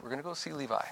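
sweet and gentle, hiding the anger; real nice. Retold or imitated. To his wife, keeping it sweet and very soft. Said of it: "I just was real nice".

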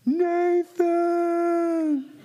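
very high, drawn-out call; imitating a faraway shout; distant calling voice. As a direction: call a name across the hills. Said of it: "I just yell, and I just hear this, this voice".

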